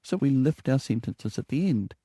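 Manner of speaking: ordinary talk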